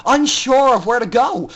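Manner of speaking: usual speaking voice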